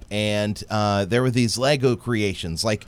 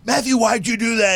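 scolding dad; 9 semitones higher than usual. Retold or imitated. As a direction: tear into the boy. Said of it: "going off on him".

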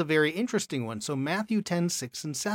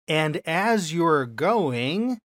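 slow emphatic reading; 2 semitones higher, 6 dB louder, much slower than usual. Shown as a demonstration.

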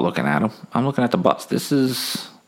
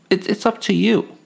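higher-pitched gunman pleading his case; tense gunman; 8 semitones higher than usual. Acted. Gunman gives a woman his other offer, high and pressing. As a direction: put the choice on her, voice pitched up.

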